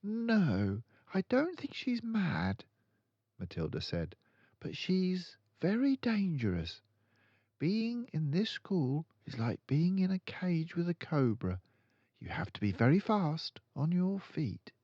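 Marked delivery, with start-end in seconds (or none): 0.0-2.7 put-on lifted high, slow girl's voice; a calm, certain young girl; Matilda's voice. Matilda correcting her friend, in a high girl's voice. 4.6-14.7 put-on a calm, certain young girl; Matilda's voice. Matilda correcting her friend, in a high girl's voice.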